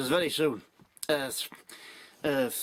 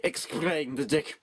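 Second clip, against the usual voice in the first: ordinary talk, nothing marked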